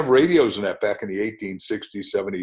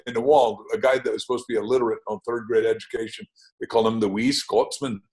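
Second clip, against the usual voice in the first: ordinary talk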